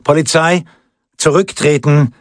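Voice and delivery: high, commanding police call; calling to the crowd. A narrator's reading. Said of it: "zur Menge wandte und rief".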